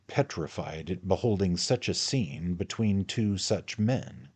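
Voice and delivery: ordinary delivery, nothing marked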